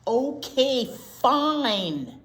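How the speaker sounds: a sulky pigeon caving in; grudging pigeon. Acted. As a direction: give in with a big reluctant sigh.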